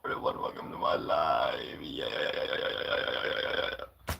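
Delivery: very low, quiet growl; growled demo voice. Shown as a demonstration. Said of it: "I guess I can a little bit".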